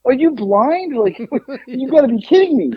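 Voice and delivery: high-pitched, laughing disbelief; retold incredulous comeback, voice jumping up; incredulous retort. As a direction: protest as if they can't be serious. Retold argument with mom about outfit.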